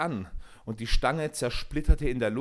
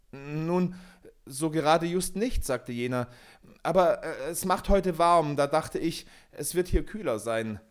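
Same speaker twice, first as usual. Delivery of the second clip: higher, hesitant, fumbling for words; nervous excuse; 5 semitones higher than usual. A narrator's reading. Peter makes excuses to the forest spirit, nervous and higher. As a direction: talk your way out, uneasy.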